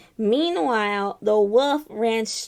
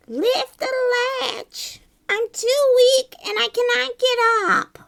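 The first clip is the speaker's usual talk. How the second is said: frail grandma; 13 semitones higher, 4 dB louder, slower than usual. Acted. Weak grandma voiced in story.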